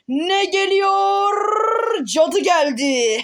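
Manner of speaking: slow, high suspenseful reveal; suspense drawl. Real, not acted. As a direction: build suspense slowly.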